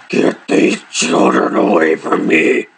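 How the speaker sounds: slow, low growl of mock desperation; exasperated growl. Fed-up sister to her brother about the kids, exasperated growl. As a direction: drag each word out in a strained, hammed-up growl.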